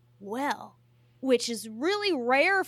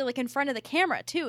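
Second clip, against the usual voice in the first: normal talking voice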